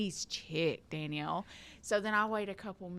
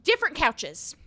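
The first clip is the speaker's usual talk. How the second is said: dad's order; 8 semitones higher, 7 dB louder than usual. Retold or imitated. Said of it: "He said".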